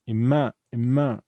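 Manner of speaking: "ima" with the second syllable punched; stress on second syllable. Shown as a demonstration. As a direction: lean hard on the "ma" in "ima". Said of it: "the stress is".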